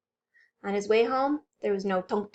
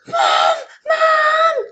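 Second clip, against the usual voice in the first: scared scream; 11 dB louder than usual. Acted. Bunny screaming for his mom in fright. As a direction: gasp then high loud screams for Mom. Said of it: "Jasper screamed".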